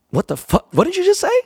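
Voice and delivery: shoots way up high in shock; can't believe what he just heard; stunned disbelief. Retold or imitated. Telling the Uber driver, high stunned disbelief. Stunned to hear [name] mentioned.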